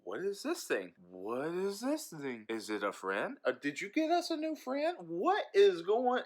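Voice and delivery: high, excited pet voice; curious dogs. Retold or imitated. Dogs voiced meeting the cat, high curious pet voice. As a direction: voice the dogs as puzzled kids. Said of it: "Raisin and Goober are like".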